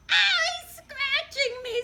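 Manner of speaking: very high, loud screech. A narrator's reading. Millicent scratched by the cat, very high screech. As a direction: yelp as if clawed. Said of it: "screeched Millicent".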